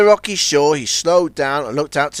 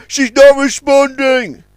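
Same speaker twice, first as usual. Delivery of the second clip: louder, slow, high; shouting; 10 semitones higher, 7 dB louder, slower than usual. A narrator's reading. Warrior shouting he can't hold the liner. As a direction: shout it over the wind. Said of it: "shouted Warrior".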